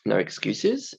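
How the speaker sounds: ordinary talk, nothing marked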